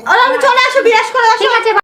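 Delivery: high, loud call across the house. Real, not acted. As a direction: call her back from a distance. Calling from afar. (উঁচু গলায় ডাকা)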